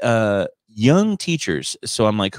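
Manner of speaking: ordinary talk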